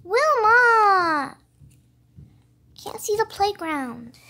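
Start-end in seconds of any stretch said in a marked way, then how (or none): 0.0-1.4 loud call across the park. Pretend. Toy calls loudly after her friend Wilma. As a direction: call her name from far away.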